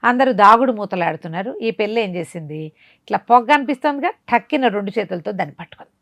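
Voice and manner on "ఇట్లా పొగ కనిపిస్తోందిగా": ordinary delivery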